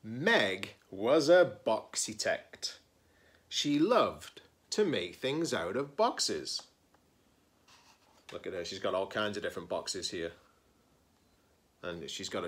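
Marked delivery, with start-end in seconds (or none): 0.0-6.6 lively picture-book read-aloud; story narration. Acted. Librarian reading a picture book to her students, expressive storytelling. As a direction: read it like story time for kids.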